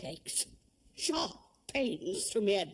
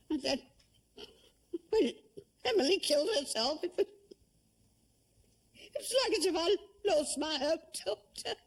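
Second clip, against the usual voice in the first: tearful; 3 semitones higher than usual. Acted. Cook weeps over Emily.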